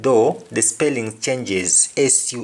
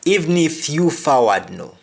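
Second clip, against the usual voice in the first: patois, Even stressed, pitched up; Jamaican patois; 5 semitones higher, 3 dB louder than usual. Shown as a demonstration. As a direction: say Even if you forward no in patois. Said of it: "the example is".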